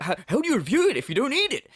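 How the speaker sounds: hammed-up incredulity; mocking echo. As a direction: parrot it back as silly. Mocks the clip's question.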